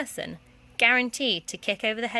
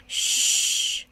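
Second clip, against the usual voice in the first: whispered shush; 5 dB louder than usual. Acted. Petula shushing the headmistress in the library. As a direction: hush her sharply. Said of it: "shushed Petula".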